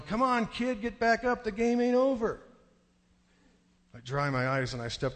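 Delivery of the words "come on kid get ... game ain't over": put-on high-pitched crowd rallying a kid; retold yelling. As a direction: imitate relatives yelling encouragement. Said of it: "they're all yelling".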